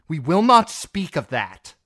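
loud, high cold anger; queen rebuking her seneschal; furious. Acted. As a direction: shut the subject down hard. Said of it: "the fury in her tone".

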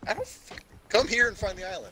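high, exasperated shout; frustrated yell. Real, not acted. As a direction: snap at him to get over here. Frustrated yelling at friend.

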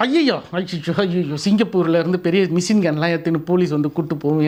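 husband scaring his wife with a fake excuse; mock fear. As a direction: exaggerate the danger in a hushed voice. Husband invents fearful excuse.

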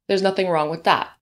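normal talking voice

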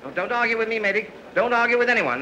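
ordinary talk

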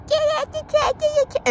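very high squeaky mock Karen voice; mock a Karen in an absurdly high pitch; Karen lady. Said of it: "some Karen lady thought".

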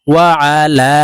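ওয়া আলা with the final 'laa' held long; three-alif madd. Shown as a demonstration. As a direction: stretch the last vowel of ওয়া আলা for three beats. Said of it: "এই লামকে তিন আলিফ টেনে বলবো". (ওয়া আলা-র লা তিন আলিফ টেনে)

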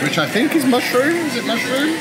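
high, excited questioning. Real, not acted. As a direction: sound delighted and unsure. Excited over vegan squid.

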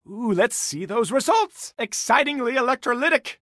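high, loud, gleeful relish; thrilled. Acted. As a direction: savour the big words, delighted. Gleeful technobabble.

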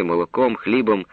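usual speaking voice

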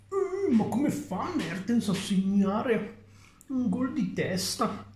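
high, slow whining fan voice; whiny fan. Retold or imitated. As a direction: whine it out like a clueless fan. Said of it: "La mentalità baccata del tifoso".

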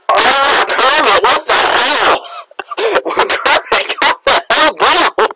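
high shocked yell through laughter; shocked laughing outcry. Real, not acted. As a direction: cry out in laughing disbelief. Shocked at Kermit's kill.

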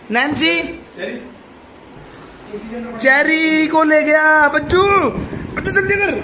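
high excited urging of pets; excited calling. Real, not acted. (जानवरों को ऊँची, उत्साहित आवाज़ में पुकारना)

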